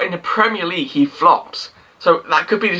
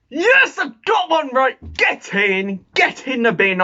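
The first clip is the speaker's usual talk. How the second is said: excited shout; 7 semitones higher than usual. Real, not acted. Football vlogger celebrating a correct prediction, shouting. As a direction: shout it like you just scored. Finally got a prediction right.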